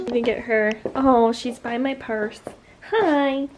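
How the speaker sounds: ordinary delivery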